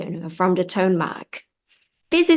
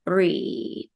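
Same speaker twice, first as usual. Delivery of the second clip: ordinary talk, nothing marked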